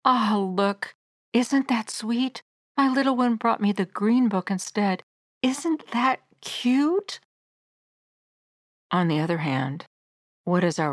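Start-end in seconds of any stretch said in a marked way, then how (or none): 0.0-7.2 higher-pitched, slow cooing delight; tender parental delight, lilting up; delighted exclamation. Acted. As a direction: coo proudly over a toddler, drawing out the last word. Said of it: "you would exclaim in delight".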